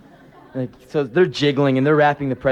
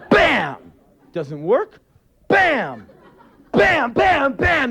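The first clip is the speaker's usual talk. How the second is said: ramming noises; 11 semitones higher, much slower than usual. Retold or imitated. Stroller ramming the table leg.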